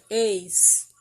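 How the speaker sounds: a loud, isolated ex syllable; loud ex sound. Shown as a demonstration. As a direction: punch out Ex on its own. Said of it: "o som do X". (sílaba Ex destacada e forte)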